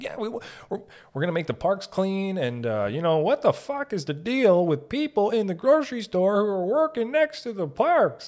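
high-pitched, hyped-up politician rant; excited politician. Pretend. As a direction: campaign with manic, overexcited energy. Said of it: "Imagine if, uh, politicians had the energy".

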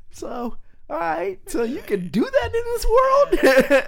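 high-pitched, wide-eyed mock amazement; amazed realization. Kid realizing what grandpa gets away with, high mock amazement. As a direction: go way up high, as if discovering a loophole. His child self marvels at grandpa.

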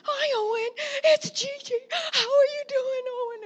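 very high, loud grandma greeting between gasps; breathless cooing. Retold or imitated. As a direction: coo to a baby while puffing along. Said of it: "I'm walking like this".

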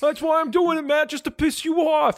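high goofy put-on voice, laughing; Vankar accent. Vankar taunting Matt in a high goofy accent. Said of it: "Nobody likes this accent".

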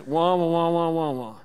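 loud muffled wah-wah drone, fading; a muted horn of a scolding parent; droning parent. Retold or imitated. Parents nagging the kids, loud muffled wah-wah.

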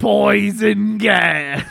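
slow, drawn-out shout; mock shout. Friend yells the fart joke, slow mock shout. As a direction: boom it out slowly like a movie title.

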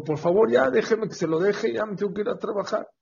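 high-pitched, hurried begging; exasperated plea. Acted. Husband begging the rabbi to let him go to work, exasperated plea.

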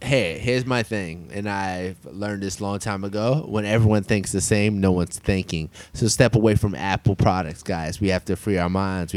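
slow, low preachy wisdom; deep mock life-coach sermon; mock guru sermon.

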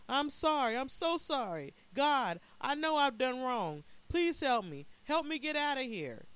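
high, loud, frantic pleading; desperate cry. A narrator's reading. Stranded preacher confesses to God and begs rescue, desperate cry. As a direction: wail your apology and beg for help. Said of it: "he cried".

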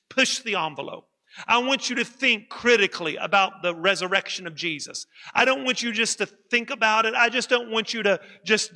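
rising, emphatic pulpit delivery; preaching. Acted. Pastor urging his congregation to study the resurrection, preaching. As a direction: preach it to the congregation with growing force.

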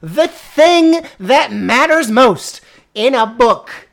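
loud, slow, high exasperation; exasperated rant. Real, not acted. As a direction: rant at the ruined climax. Exasperated rant about the climax.

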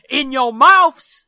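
slow, very loud put-on shout; hammed-up rowdy holler; unliterary yell. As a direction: bellow it crudely for laughs. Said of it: "I don't think they talk like that".

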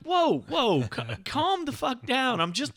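very high, drawn-out protest; mock alarmed calming. Husband telling wife to calm down, high mock alarm.

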